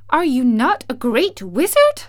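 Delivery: loud, high incredulous question; astonished cry. A narrator's reading. Dorothy questions the little man, astonished. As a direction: challenge it in loud disbelief. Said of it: "cried Dorothy".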